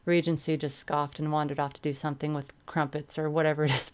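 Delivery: usual speaking voice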